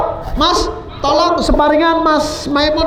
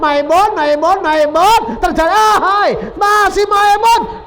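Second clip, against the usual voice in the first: excited build; 7 semitones higher than usual. Real, not acted. Commentator building on a dribble.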